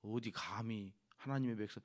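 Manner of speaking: ordinary delivery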